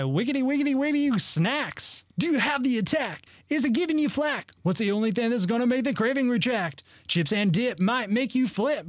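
bright, high, peppy announcer pitch; chipper 1950s ad. Acted. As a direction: sell the snacks like an old TV spot. Reads a joke snack commercial.

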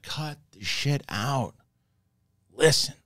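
high-pitched, drawn-out reprimand; exasperated scolding. Retold or imitated. King to his scrapping family, exasperated scolding. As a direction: imitate a fed-up king calling for order.

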